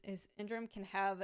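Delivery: ordinary talk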